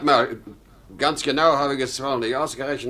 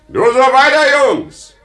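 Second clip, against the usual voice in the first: put-on loud cheer to the boys, pitched high; calling out; 11 semitones higher, 9 dB louder, slower than usual. Father calling out to his sons, cheering loud. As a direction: shout encouragement across the yard.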